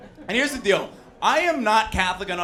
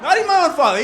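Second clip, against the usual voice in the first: loud, high shout to the room; calling out; 12 semitones higher, 11 dB louder, faster than usual. Real, not acted. Comic calls out his own father in the audience, loud shout. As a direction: holler it at the audience.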